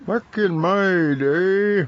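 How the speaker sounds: put-on slow, high creaky old-timer voice. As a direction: drag it out like a grumpy old-timer. Joking old-timer aside.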